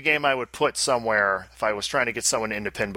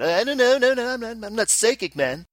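higher, flustered mock denial; nervous backpedal; 9 semitones higher than usual. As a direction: squirm out of it. Backing off the mock threat.

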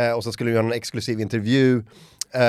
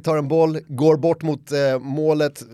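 ordinary talk

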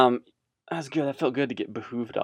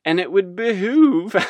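laughing; 9 semitones higher, 5 dB louder than usual. Real, not acted. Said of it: "I need to stop with that word".

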